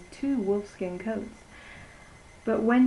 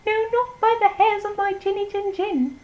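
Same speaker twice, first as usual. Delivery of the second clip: much higher, louder little piggy voice; squeaky little pig; 12 semitones higher, 5 dB louder than usual. Acted. The little pig refusing the wolf in a high squeaky voice. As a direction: squeal it back like a tiny scared pig.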